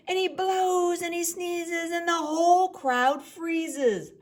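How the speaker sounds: high and loud, breathless; excited loud narration. A narrator's reading. Reading to children, loud after the big sneeze. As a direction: blast it out.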